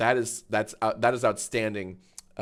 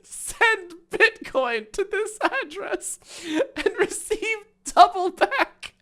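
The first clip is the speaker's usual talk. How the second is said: much higher, slow, wheezing with laughter; laughing; slower than usual. Real, not acted. Streamer reads a scam post, laughing hard. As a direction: read it while crying laughing.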